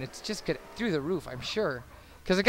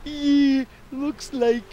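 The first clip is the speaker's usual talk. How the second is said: high, loud cartoon voice borrowed from a TV show; pitched-up silly cartoon impression; Simpsons voice; 9 semitones higher, 4 dB louder, much slower than usual. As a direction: quote it like a Simpsons gag. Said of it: "something I ripped off The Simpsons".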